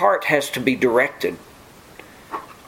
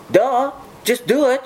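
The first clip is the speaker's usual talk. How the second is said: higher, slow, mock-obvious "duh"; a drawn-out, higher teasing brush-off; 10 semitones higher than usual. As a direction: say it like the answer is too obvious to explain. Rule needs no explanation.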